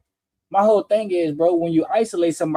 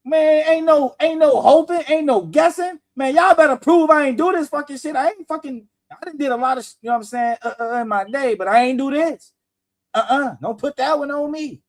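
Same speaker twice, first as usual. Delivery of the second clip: innocent man's protest; 6 semitones higher than usual. Retold or imitated. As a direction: demand it like a falsely accused man. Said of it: "ain't no innocent man talking like that".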